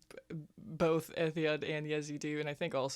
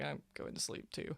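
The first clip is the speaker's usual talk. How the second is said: sleepy whisper; 7 dB quieter than usual. Acted.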